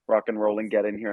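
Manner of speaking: normal talking voice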